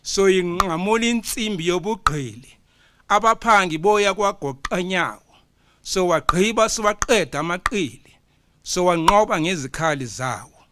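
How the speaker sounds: loud, high declaimed stanza, punching each verb; poem recital. Acted. Poet reciting the freedom stanza to the conference, loud declamation. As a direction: thunder the stanza out like a praise poet.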